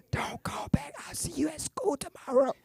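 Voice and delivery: high, hushed mock whisper. High schooler ending a late-night call, whispering. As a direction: whisper a secret goodbye into the phone. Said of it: "real good at whispering in high school".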